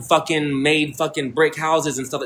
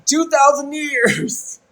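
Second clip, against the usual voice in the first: much higher, slow, drawn out "thousand"; stretched incredulous repeat; 12 semitones higher, much slower than usual. Real, not acted. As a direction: stretch "Two thousand years" high and slow, amazed. Incredulous repeat after laughing.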